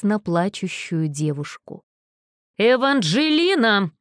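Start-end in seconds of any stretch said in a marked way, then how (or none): 2.6-3.9 loud, slow bellowed name; roaring. A narrator's reading. Furious mother roars at her daughter from the carriage. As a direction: roar the name like an angry mother. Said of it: "взревела она".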